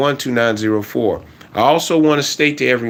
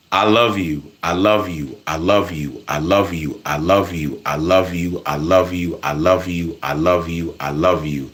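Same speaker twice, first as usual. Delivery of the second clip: tender self-talk; 7 semitones lower than usual. Shown as a demonstration. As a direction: say it gently to yourself in the mirror. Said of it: "Talk to yourself".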